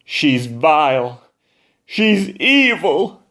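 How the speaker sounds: high, slow, outraged shriek; shrieking crowd. Acted. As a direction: shriek it in disgust. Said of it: "Now the audience shrieked".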